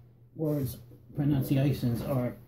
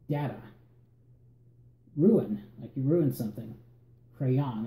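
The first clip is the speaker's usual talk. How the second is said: normal talking voice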